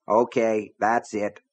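ordinary delivery

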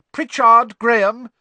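loud, high roll call; calling out names. A narrator's reading. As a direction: summon a pupil across the hall. Teacher calls out name.

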